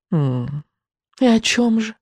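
witch's mocking hum and question; snide inquiry. A narrator's reading. Witch doubts the boy has a guess, snide. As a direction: lilt up with mild sarcasm. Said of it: "язвительно поинтересовалась ведьма".

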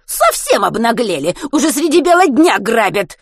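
loud, high scandalised cries; outraged ranting. A narrator's reading. Old woman accusing boy of robbery, outraged. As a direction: raise a public alarm, indignant old woman. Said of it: "не унималась женщина".

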